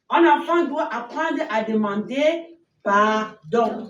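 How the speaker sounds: plus fort, lent, chaque mot appuyé; martèle la morale aux enfants; leçon martelée. Shown as a demonstration. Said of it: "annonce la leçon du matin". (la monitrice martèle la leçon, fort et lentement)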